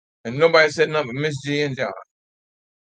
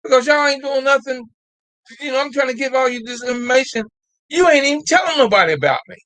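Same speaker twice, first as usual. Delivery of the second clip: higher, exasperated scolding; pissed off; 6 semitones higher than usual. Real, not acted. Host scolding viewers who ignore her, exasperated. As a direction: scold the audience for doing nothing. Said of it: "I'm getting pissed off".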